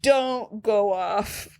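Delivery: very slow, spaced-out pronouncement; mock-solemn rule. Stating the number one IndyCar rule, mock-solemn. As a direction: lay down the law word by word, slowly.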